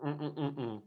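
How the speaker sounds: humming through stuffed cheeks; cheeks stuffed full. Real, not acted. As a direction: cheeks crammed full, lips shut. Mouth full of marshmallows.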